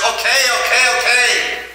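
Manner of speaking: high, exasperated okays; fine, fine, just stop; giving in. Retold or imitated. Rich man gives in, exasperated.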